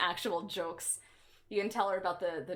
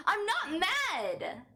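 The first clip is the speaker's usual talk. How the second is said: high, very slow mock denial; mock not mad; 13 semitones higher, 4 dB louder, much slower than usual. Streamer protesting to chat that she is not mad, slow and high. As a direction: drag it out pretending calm.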